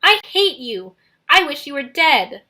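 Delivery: loud hateful yell. Acted. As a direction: spit the wish at him. Nico yelling at Percy.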